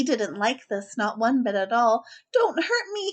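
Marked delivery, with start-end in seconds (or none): 2.3-3.1 high frightened whimper; a big lion begging in fear; mock-scared lion whimper. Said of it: "he whimpered".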